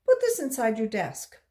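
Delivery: loud firm order; commanding man. A narrator's reading. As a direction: hand it back with a curt command.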